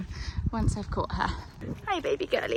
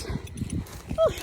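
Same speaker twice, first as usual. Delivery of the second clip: high breathy whew; breathless whew. Real, not acted. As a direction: blow out a tired whew. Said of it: "out of breath".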